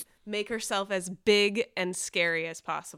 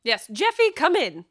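raised, loud call through the door; loud summons; 6 semitones higher, 8 dB louder than usual. Acted. Eloise calls loudly to Jeffy at the door. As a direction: command him to come inside. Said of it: "Eloise is gonna be like".

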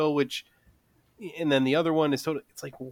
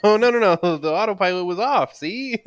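mock excuse; 7 semitones higher, 6 dB louder than usual. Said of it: "the dog ate my homework".

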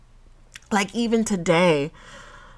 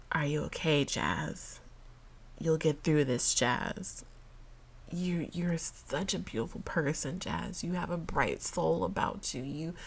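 soft, near-whispered pep talk to herself; self-comfort; 11 dB quieter than usual. Real, not acted. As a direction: gently reassure yourself while holding back tears.

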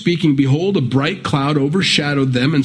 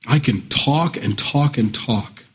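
normal talking voice

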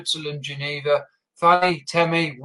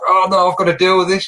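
mock dismay; 5 semitones higher, 6 dB louder than usual. Voices owner facing sudden problem.

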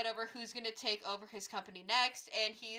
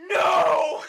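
high, loud shout of disbelief; mock horrified scream; 6 semitones higher, 16 dB louder than usual. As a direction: shriek it as an over-the-top reaction. Reenacting shock at the video reveal.

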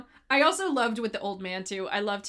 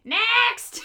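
high, loud screech of a summons; imitated scream; 11 semitones higher, 5 dB louder than usual. Friend imitating villainess calling the following peasant, mock scream. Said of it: "Love her scream".

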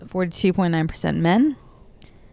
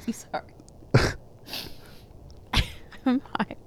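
giggly; 6 semitones higher, 8 dB quieter than usual. Real, not acted. Giggling apology after odd handoff.

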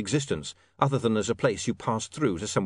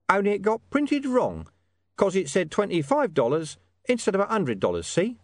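high, wheedling informant; 9 semitones higher than usual. Acted. Hidden informant talking William into a bigger reward, high and wheedling. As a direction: haggle from the shadows, pitched well up. Deep Bone bargains for reward.

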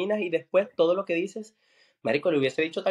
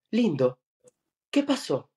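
put-on a higher, playful teasing boyfriend voice; 3 semitones higher, slower than usual. Boyfriend teasing his partner into laughing, playful higher voice. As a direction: coax him with a sly, sweet question.

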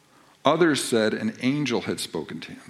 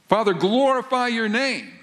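pleading cry; 10 semitones higher, 3 dB louder than usual. Acted. Dramatic quote of Jesus' cry.

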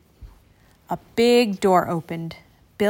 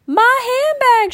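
much higher, louder, slow cry; scream; 7 dB louder than usual. A narrator's reading. Rich lady screaming as her handbag flies overboard. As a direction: shriek as the bag flies off. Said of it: "she screamed".

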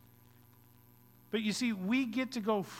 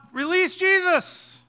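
high, loud, drawn-out shout; crowd yell; 10 semitones higher, 7 dB louder, slower than usual. Acted. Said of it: "yell out".

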